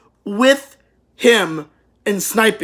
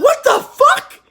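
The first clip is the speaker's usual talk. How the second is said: much higher, louder shriek breaking into laughter; laughing shock; 4 dB louder, slower than usual. Retold or imitated. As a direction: shout it in disbelief while cracking up. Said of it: "and she's like".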